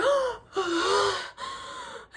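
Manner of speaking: high, loud gasp for air; waking from sleep, gasping for breath; desperate gasp for air. Retold or imitated. Reenacting choking in sleep.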